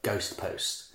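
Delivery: whispered musing. Real, not acted. Musing on the title.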